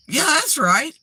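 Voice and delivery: high, emphatic jump-on-board agreement; imitated tone change. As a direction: mimic latching eagerly onto a new idea. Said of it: "That was new concept to you".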